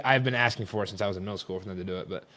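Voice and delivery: ordinary talk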